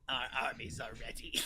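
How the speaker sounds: hissing whispered snake voice; lightly hissed serpent villain. Retold or imitated. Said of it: "Dante's weird snake voice".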